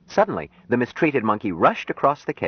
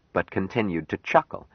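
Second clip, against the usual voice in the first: ordinary delivery, nothing marked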